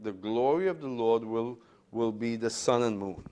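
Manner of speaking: usual speaking voice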